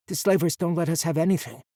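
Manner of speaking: put-on plain childlike explanation; boy's voice. A narrator's reading. Boy explaining captivity.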